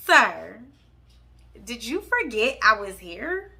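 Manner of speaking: loud "Sir", then affronted questioning; indignant confrontation. Retold or imitated. Girlfriend confronting boyfriend with a girl on his lap, indignant.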